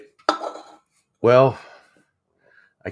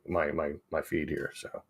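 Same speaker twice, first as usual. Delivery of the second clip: normal talking voice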